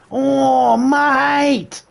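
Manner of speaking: high squeezed cartoon Aussie "Oh, mate"; bad Australian accent. Shown as a demonstration. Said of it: "A bad Australian accent will normally sound like this".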